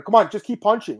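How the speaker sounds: mock yell, a coach urging a fighter on; imitated coach's scream. Coach urging his fighter in the cage, imitated mild yell. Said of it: "he was screaming at her".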